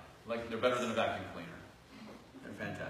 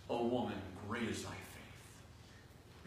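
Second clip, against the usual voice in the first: hushed tender words; 5 semitones lower, 11 dB quieter, slower than usual. Retold or imitated. Jesus's words, tender climax.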